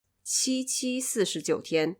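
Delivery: "七七四十九天" said in clear native Mandarin tones, qī qī sì shí jiǔ tiān; Mandarin pronunciation. Shown as a demonstration. Host quoting the original novel's line for listeners, in Mandarin. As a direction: read "七七四十九天" as the original Chinese text, each tone crisp. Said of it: "what it actually said was".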